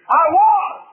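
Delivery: high, loud preacher's yell; shout from the grave. Acted. Preacher voices dead gods in the grave, high shout. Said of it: "have to shout from the grave".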